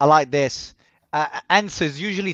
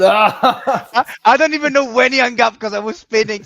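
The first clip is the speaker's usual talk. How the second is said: excited; 6 semitones higher, 9 dB louder than usual. Real, not acted. After spinning call, laughing.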